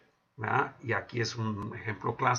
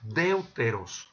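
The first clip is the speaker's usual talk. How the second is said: deuteros said slowly, each syllable spelled out DEU-TE-ROS; slow, careful Greek word; 2 semitones lower, much slower than usual. Shown as a demonstration. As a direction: stretch deuteros out syllable by syllable for the students. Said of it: "que en este caso es".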